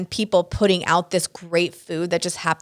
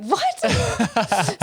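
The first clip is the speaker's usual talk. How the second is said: much higher, drawn-out disbelief; stunned what; 13 semitones higher than usual. Retold or imitated. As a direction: let it hang in shock. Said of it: "He's like".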